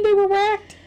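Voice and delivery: high-pitched teasing question; incredulous echo. Acted. Co-host querying whether they were whacked in the mansion, incredulous. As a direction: repeat it in high mock disbelief. Mock-puzzled repeat of host's word.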